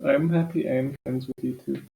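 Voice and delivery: slight Russian accent; a hint of Russian vowels. Real, not acted. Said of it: "you had your Russian accent there".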